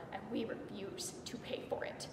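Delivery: near-whispered, very soft refusal; hushed defiance. Acted. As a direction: drop almost to a whisper, resolute. Defiance drops to a hush.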